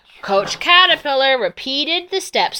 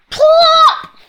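comic plop shout; 9 dB louder than usual. Acted. Inchworm lands a jump.

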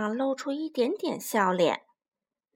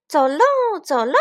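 high, loud gleeful chant; joyful cheer; 4 semitones higher, 8 dB louder than usual. Acted. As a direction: cheer as you skip outside. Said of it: "他们俩兴高采烈地出门了".

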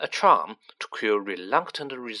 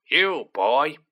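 snarl; 3 semitones higher, 5 dB louder than usual. A narrator's reading. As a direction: snarl it at the boy. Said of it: "he snarled at Harry".

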